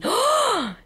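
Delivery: high startled shriek of the nurse; surprised shriek. Retold or imitated. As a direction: shriek as if caught off guard. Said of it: "Se llevó su sorpresa". (grito agudo de sorpresa de la enfermera)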